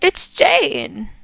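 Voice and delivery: put-on high, hushed, pleading young woman; a grieving daughter begging her dead mother for the truth; Jane, pleading daughter. Daughter Jane begs the psychic's spirit mother, high and pleading.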